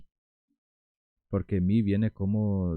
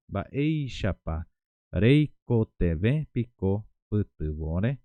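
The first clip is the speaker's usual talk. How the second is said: "Baeishapa", then "reiko tebe piko putubore?" spoken very slowly with a rising end; slow, word by word; 5 dB louder, much slower than usual. Shown as a demonstration. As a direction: spell each word out slowly for learners. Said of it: "Entonces digo". (despacio, palabra por palabra)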